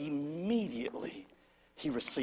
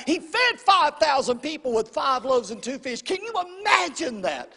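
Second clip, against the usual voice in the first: loud, high preaching that piles up the crowd's questions; preaching at full force; 5 semitones higher, 5 dB louder than usual. Acted. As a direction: keep building the excitement of the crowd's gossip.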